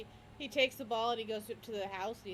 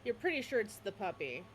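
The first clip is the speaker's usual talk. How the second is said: ordinary talk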